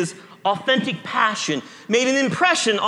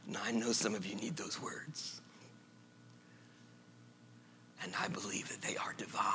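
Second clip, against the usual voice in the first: hushed; 10 semitones lower, 16 dB quieter than usual. Real, not acted.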